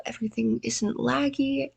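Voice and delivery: usual speaking voice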